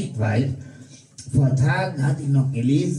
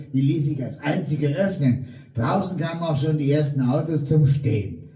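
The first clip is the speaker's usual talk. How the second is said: usual speaking voice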